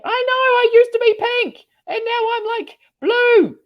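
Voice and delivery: very high, loud comic whine; exaggerated high sing-song lament; high mock whine. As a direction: squeak it like a pouting confession.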